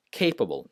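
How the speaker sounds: CAY-pa-ble, strong first syllable in capable; stress on first syllable. Shown as a demonstration. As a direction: hit the opening of capable. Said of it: "not capable".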